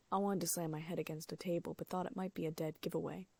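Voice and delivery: ordinary delivery, nothing marked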